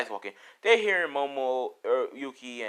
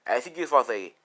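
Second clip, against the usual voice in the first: usual speaking voice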